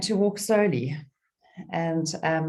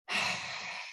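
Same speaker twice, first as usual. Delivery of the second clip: long breathy sigh out; slow releasing exhale; demonstrated sigh; 10 dB quieter than usual. Shown as a demonstration. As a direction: let a soft sigh out through the mouth. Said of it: "sighing out through your mouth".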